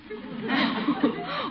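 high rolling chuckle. Acted. Uncle mocking Leroy's feet, high rolling chuckle. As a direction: laugh at the boy's clumsy feet.

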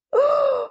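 a loud, high gasp of shock; startled stage gasp; sharp gasp. Acted. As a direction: gasp as if something just broke. Donkey's tail pops off.

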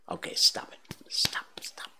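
unvoiced whispered aside. Real, not acted. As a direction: whisper it to yourself. Self-scolding aside, whispered.

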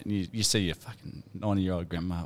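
ordinary delivery

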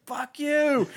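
high, loud furious yell; mock outburst at the soldier who apologized; angry yell. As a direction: snap it back in a rage. Angry wounded soldier quote.

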